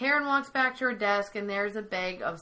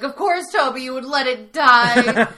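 loud, higher-pitched outburst of blame; mock-outraged accusation, getting louder; freaking out; 3 semitones higher, 11 dB louder than usual. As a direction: imitate someone freaking out, dragging the last words. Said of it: "Michael starts freaking out".

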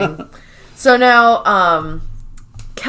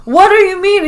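high, loud indignant squawk; hammed-up baffled Dwight, voice jumping up; mock outraged Dwight; 11 semitones higher, 8 dB louder than usual. Dwight demanding an answer from Jim, high and loud.

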